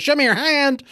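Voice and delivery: high, loud imitation of alarmed wife; wife's frantic yell, re-enacted; alarmed demand. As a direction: shriek the demand like a panicked spouse. Imitating wife demanding to see injury.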